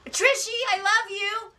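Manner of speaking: loud, high-pitched gushing shout; eardrum-blasting holler. Real, not acted. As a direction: holler an affectionate greeting at full volume. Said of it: "did I blow out everybody's eardrums".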